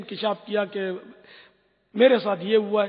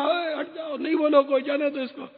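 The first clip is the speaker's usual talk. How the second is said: retold call, a touch higher, telling people to back off; calling out to the crowd; 5 semitones higher than usual. Recalling how he shooed the crowd off the cyclist, mock call. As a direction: wave the crowd away from the boy, mildly raised.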